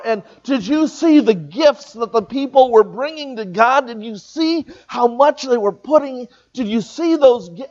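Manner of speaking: high, eager gushing over the temple; excited disciples. Retold or imitated. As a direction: gush like awestruck disciples showing off the sights.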